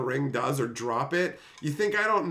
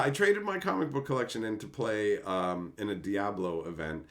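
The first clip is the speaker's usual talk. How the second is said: ordinary talk, nothing marked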